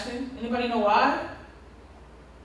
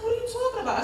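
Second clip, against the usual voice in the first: lightly imitated baffled child, higher voice; puzzled kid; 10 semitones higher, 6 dB quieter, much faster than usual. Kid questioning the assistant principal, lightly imitated puzzlement. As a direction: pitch it up a little, like a confused kid.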